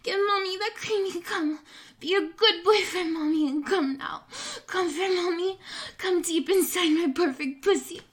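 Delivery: heated climax urging; breathless urging. Acted. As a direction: push him over the edge, voice climbing. Acted climax, urging, higher louder.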